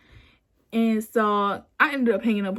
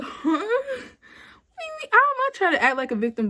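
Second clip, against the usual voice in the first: incredulous; 12 semitones higher than usual. Retold or imitated. Woman retelling her reply to being called a victim, incredulous. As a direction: squeak the question, then rush the protest.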